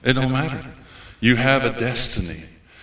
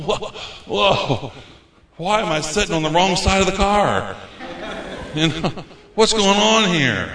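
higher, amused mock confusion, last bit slowed; imitating bewildered tourist; 7 semitones higher than usual. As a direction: play a lost visitor baffled by the car. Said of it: "We're walking around going".

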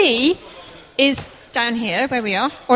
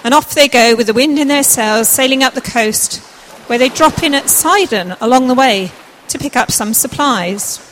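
put-on loud, projected narration for children; scene-setting read aloud, loud and clear; story narration; 6 dB louder, slower than usual. A narrator's reading. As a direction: tell the story out to the whole room.

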